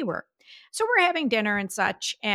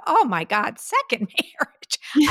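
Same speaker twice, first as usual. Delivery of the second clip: amused disbelief; 6 semitones higher, 4 dB louder, slower than usual. Retold or imitated. Acting out her startled thought.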